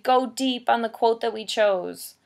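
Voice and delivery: usual speaking voice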